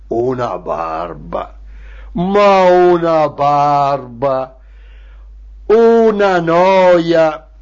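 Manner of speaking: slow, dragged-out tedium; bored groan. Acted. Storyteller on the tedious fascist drills, bored groan. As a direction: stretch every vowel with utter boredom.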